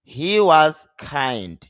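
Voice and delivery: he was kind, kind aspirated, slow and loud; slow demo. Shown as a demonstration. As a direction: clear teacher model. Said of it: "খে বললে তাহলে বলবো kind".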